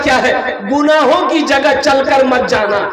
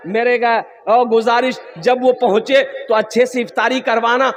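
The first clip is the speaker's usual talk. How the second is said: normal talking voice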